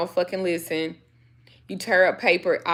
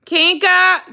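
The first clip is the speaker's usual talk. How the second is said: calling the dog; 10 semitones higher, 8 dB louder than usual. Real, not acted.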